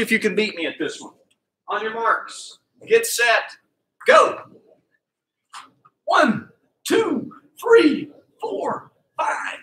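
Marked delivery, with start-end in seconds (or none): 1.7-4.4 race start. Shown as a demonstration. Coach launching the kids into the race with a yell. 6.0-9.6 excited count. Shown as a demonstration. Coach counting fast at high pitch.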